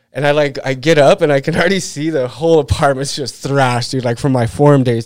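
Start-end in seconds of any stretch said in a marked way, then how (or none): none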